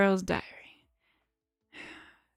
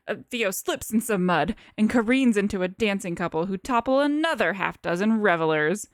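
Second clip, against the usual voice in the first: ordinary delivery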